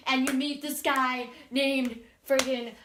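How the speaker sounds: ordinary talk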